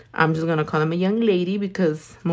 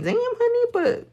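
high mock squeal. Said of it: "But I was like".

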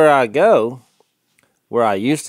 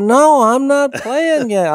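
put-on indignant old man; 14 semitones higher, 6 dB louder, slower than usual. Old man denies playing games.

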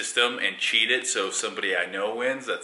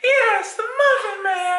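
very high, loud put-on squeal; emphatic answer; 5 dB louder, slower than usual. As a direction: confirm "Muffin Man" with hammed-up hysteria. Acting the emphatic answer in bit.

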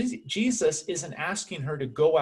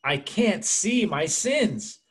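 high, drawn-out acted frustration; obvious frustration; 6 semitones higher, slower than usual. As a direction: wail it slowly in mock exasperation. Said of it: "Then in obvious frustration".

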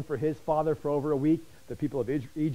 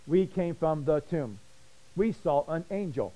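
"We came from the tomb" drawn out slowly, no excitement; slow, flat talk; slower than usual. Shown as a demonstration. Preacher showing how the women did NOT speak, slow. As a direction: deliver it deliberately slow and calm. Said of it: "you're not gonna talk slow and say".

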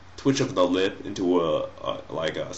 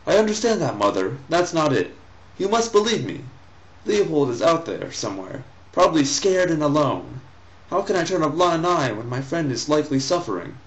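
lightly voiced boy, raised pitch, earnest; pleading son; 6 semitones higher than usual. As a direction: read the son's plea a little higher. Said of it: "Ahmad pleads with his mother".

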